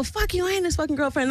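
high, fast mock comeback; retold indignant retort. Acting out her own angry retort.

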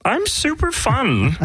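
very high, drawn-out cartoon alien; high-pitched little alien; Marvin the Martian. Shown as a demonstration. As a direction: plead your case in a high, slow Martian voice. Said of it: "What about Marvin the Martian?".

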